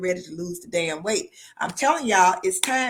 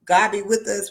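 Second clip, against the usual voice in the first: ordinary talk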